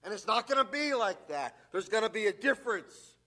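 rising fervent preacher's voice; preaching at full force. Acted. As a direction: drive each point home to the congregation.

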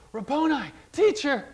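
high, sudden exclamation; glad, high-pitched outburst; joyful recognition. Acted. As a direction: cry out as you recognize a beloved teacher. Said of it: "with joy".

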